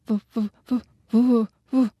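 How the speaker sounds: frightened stammer. Acted. Yoshi stammering in shock at the talking ghost. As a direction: stutter like you can't get the word out. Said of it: "stammelte er".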